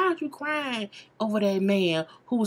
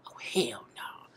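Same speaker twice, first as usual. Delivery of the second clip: hushed, slow oh hell no; a stunned whisper aside; whispered shock; 9 semitones lower, 9 dB quieter than usual. Real, not acted. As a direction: mutter it under your breath in disbelief. Shocked aside under breath.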